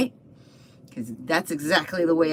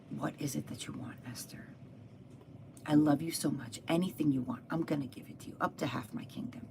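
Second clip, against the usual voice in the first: soft, hushed, loving king; doting; 8 dB quieter than usual. Retold or imitated. King offers his queen anything she wants, softly doting. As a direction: speak tenderly and quietly, as to a beloved wife.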